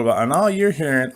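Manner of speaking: normal talking voice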